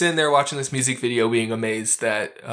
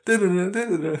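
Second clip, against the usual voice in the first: sung-out TV transition sting, rising higher; Batman effect; 7 semitones higher than usual. Retold or imitated. Podcaster mimicking the film's camera sting, playful Batman effect. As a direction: imitate a quick scene-change jingle. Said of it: "it does, like, a Batman effect".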